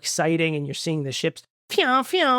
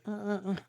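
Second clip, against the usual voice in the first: straining grunts; 3 semitones higher, 12 dB quieter, faster than usual. Retold or imitated. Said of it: "they're always like".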